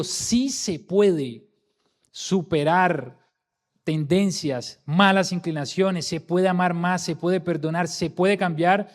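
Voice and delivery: slow, emphatic rallying declaration; energetic conviction. Shown as a demonstration. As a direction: make them believe it can be done. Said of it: "pero tiene que haber energía".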